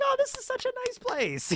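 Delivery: high, airy mock customer gush. Customer admiring the cute bakery, high mock gush. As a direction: coo it like a charmed shopper.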